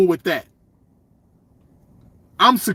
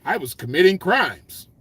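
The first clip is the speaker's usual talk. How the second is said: mocking a nerd; 3 semitones lower than usual. Mocking the nerd who never did crimes, a prim low voice. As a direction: say it like a sheltered nerd. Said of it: "But we don't say".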